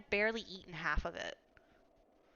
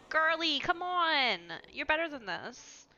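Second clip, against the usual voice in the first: exasperated; 6 semitones higher, slower than usual. Real, not acted. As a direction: throw up your hands, exasperated. Urging character, loud high.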